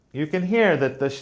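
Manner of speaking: normal talking voice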